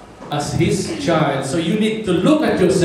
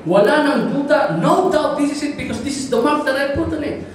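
put-on high, mounting boyish insistence; excited certainty; 8 semitones higher than usual. Boy finding his own mark on the toy, excitedly. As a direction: climb higher as the proof piles up. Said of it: "Sabi niya".

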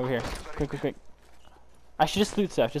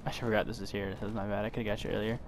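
normal talking voice

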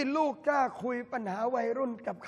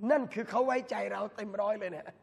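ordinary delivery

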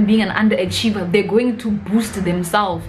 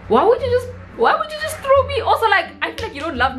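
much higher pleading whine; mock plea; 12 semitones higher, faster than usual. Child to parents sending them to the wolves, high mock plea.

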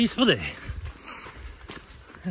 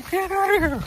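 shouting. Real, not acted. Shooing a turkey on trail.

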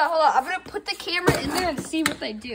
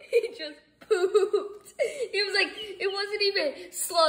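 laughing; 6 semitones higher, slower than usual. Real, not acted. Duck poops, kids laugh.

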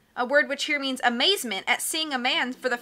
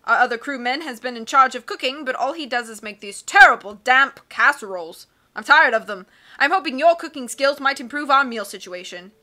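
loud, hearty captain voice; blustery sea captain; 7 dB louder than usual. Acted. Captain puzzling over charts, loud and hearty. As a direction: boom like a cheerful old sea dog.

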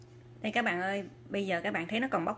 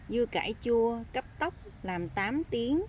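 usual speaking voice

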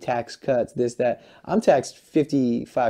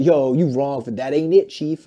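mocking a fan; 3 semitones higher, 3 dB louder, slower than usual. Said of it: "the people are like".

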